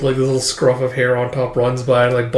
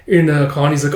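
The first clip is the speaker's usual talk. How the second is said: plain reading voice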